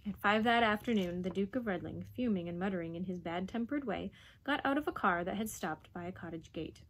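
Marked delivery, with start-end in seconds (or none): none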